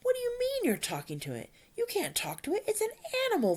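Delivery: very high-pitched disbelief; acted astonishment, voice shooting up high; astonished. A narrator's reading. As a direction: squeak out your incredulous objection. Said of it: "Fishlegs in astonishment".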